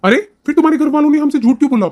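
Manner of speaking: angry at being lied to; indignant outburst. Acted. Husband outraged at lie.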